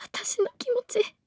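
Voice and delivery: begging through tears; pleading, on the edge of crying; tearful plea. Acted. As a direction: voice cracking high. Girl pleads, voice breaking high.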